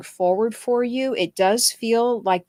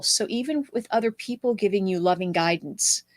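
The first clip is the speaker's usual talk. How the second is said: normal talking voice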